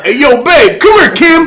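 high, loud excited holler; acted neighbor shouting indoors; calling to wife. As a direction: summon her from across the house. Acts neighbor calling his wife.

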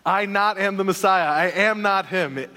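playful quote with laughter in it; laughing mock quote. Preacher still laughing, mock-quoting John the Baptist's denial. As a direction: keep laughing through the garbled line. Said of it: "what John says here is".

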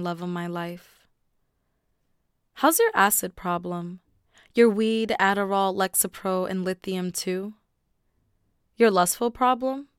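strong, rising spoken-word delivery; spoken word poem read aloud; poetry reading. Acted. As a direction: perform the poem with building force. Reads the Welcome Back poem.

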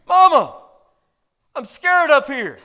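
put-on high, loud child calling, slowing on scared; scared little boy. Scared boy calls down to his mama, high and loud. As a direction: call out frightened from a dark bedroom. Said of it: "boy calls out scared".

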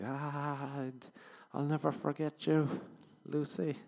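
hammed-up heartfelt parting line; overdone romantic farewell; sappy farewell. Acting out tender farewell quote.

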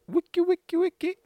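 very high, loud wiki-wiki scratch. Pretend. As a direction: imitate a record scratch. DJ scratch noise.

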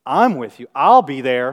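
loud, cocksure pledge; punchy, overconfident vow at full volume; proud boast. Acted. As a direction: declare loyalty with swaggering pride. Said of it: "that's pride".

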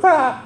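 high-pitched startled "ah"; mock donkey yelp of alarm; freaked-out donkey cry. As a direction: yelp like a spooked animal, playfully. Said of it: "freaks out, goes".

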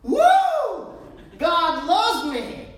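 high, loud whoop, then a slow drawn-out line; joyful cheer. Retold or imitated. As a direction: cheer it out like you just got rescued. Mock joyful cheer quoted.

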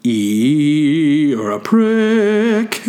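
high, slow, drawn-out sneer; drawn-out disgusted retort; mock disgusted reply. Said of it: "if you say it like that".

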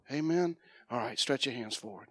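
ordinary delivery

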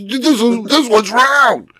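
high, slow whiny imitation of the wife; mocking wife voice. Husband imitating his wife insisting the tree is round, high mocking whine. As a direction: drag it out high to mock her. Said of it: "She goes".

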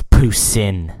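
"poussin" as a drawn-out poo-SIN; slow "poussin" with an s for the t; Quebecois pronunciation. Shown as a demonstration. Tour guide teaching the real way, Quebecois pronunciation. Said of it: "the proper way to say poutine".

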